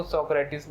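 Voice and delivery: सोक्रेटिस said so-kre-tis, with three syllables; foreign pronunciation. Shown as a demonstration. Teacher showing how some foreigners say it, सोक्रेटिस ending in -tis. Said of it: "कुछ foreign के लोग".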